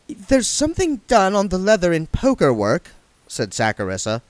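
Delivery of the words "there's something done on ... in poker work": put-on higher girl's voice; young woman voice. A narrator's reading. Saccharissa tells William about the wallet, higher.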